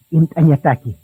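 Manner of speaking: ordinary talk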